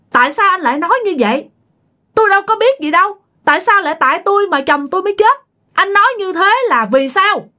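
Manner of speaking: high, fast, stunned questioning; shocked protest. Retold or imitated. Startled widow questioning the officer who blames her. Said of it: "bà ngoại mới hết hồn".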